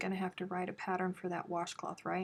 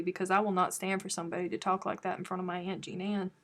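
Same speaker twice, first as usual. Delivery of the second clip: ordinary delivery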